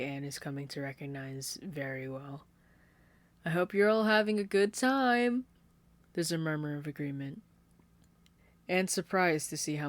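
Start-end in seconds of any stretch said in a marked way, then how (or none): 3.4-5.5 high and loud, sugary hostess voice; sweet sing-songy. Acted. Caitlin addressing her party guests, sweet sing-song. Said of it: "the sweet, sing-songy way".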